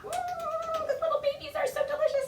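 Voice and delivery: high fast hammed-up yum; playful mock hunger. Librarian pretending the babies taste delicious. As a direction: squeal like a greedy ogre.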